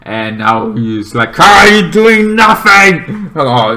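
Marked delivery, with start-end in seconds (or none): 1.4-3.3 loud, higher, drawn-out challenge; indignant outburst. Acted. Recapping God's reaction to the idle heroes, indignant. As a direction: demand it loudly, stretching the words.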